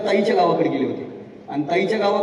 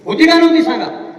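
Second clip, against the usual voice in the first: rhetorical appeal to audience; 9 semitones higher, 7 dB louder than usual. Real, not acted. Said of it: "asking the audience".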